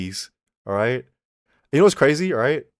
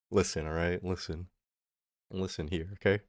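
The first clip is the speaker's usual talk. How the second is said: quiet; 5 semitones lower, 10 dB quieter than usual. Real, not acted. Calling for attention softly.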